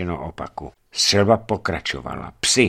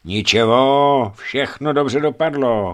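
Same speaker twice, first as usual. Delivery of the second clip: soothing; 6 semitones higher, 6 dB louder, slower than usual. A narrator's reading. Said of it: "chlácholila ho Tamara".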